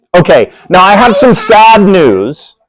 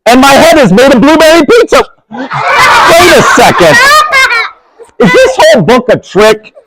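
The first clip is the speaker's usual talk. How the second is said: hammed-up flustered reader; 12 semitones higher than usual. Reader to giggling kids, hammed-up mock panic. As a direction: ham up being tricked by the book. Performing silly book text, protesting.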